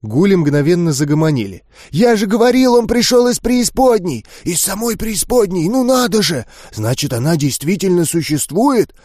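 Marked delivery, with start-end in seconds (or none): 1.9-8.9 high, eager babble of ghouls; excited ghouls. Acted. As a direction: gossip in thrilled amazement.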